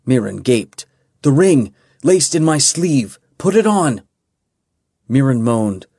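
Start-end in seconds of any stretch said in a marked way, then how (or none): 1.2-4.0 urgent command. A narrator's reading. Kaelin urging Mirren in storm.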